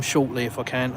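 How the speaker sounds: usual speaking voice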